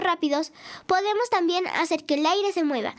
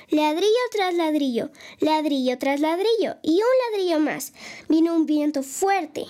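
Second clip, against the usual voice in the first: put-on storytelling to kids. Host reading a story to children at home, lively narration. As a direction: tell the tale to little listeners with gusto.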